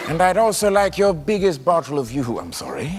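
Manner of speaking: a hint of an awkward donut buyer; Lorne audition character. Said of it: "I did him in my audition".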